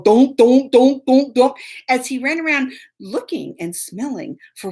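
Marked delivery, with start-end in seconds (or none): 0.0-1.6 loud imitated thuds of a block hitting wood; thudding block noise. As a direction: bang out each donk like a heavy block. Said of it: "the cinder block going".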